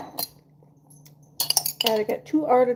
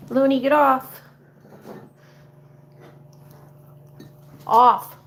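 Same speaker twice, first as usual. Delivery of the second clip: loud firm command to a pet; firm shout; 2 semitones higher, 7 dB louder, slower than usual. Real, not acted. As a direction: bark "off" at the cat. Shooing the cat away.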